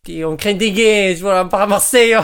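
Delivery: loud, boastful mock Marseille accent. Contestant showing how Marseille talks, loud mock Marseille accent. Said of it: "on parle comme ça".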